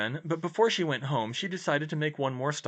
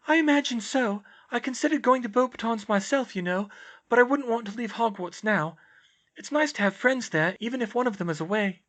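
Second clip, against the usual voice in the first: high-pitched, airy character voice; dreamy high girl's voice; serene oddball girl; 11 semitones higher, 5 dB louder than usual. Acted. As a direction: state odd theories untroubled, pitched up. Voices Luna in dialogue.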